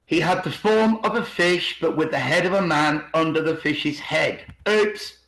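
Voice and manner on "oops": ordinary delivery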